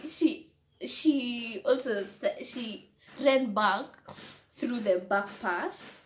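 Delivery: normal talking voice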